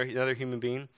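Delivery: normal talking voice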